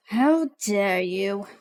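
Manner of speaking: low, slow menacing snarl; drawn-out villain's snarl. Acted. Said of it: "Artemis just snarls at you".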